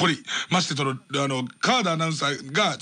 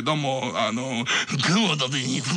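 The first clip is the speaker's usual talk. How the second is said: imitation; 7 semitones lower than usual. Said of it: "モノマネじゃねえ".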